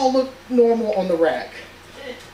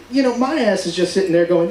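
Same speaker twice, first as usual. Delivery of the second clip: ordinary talk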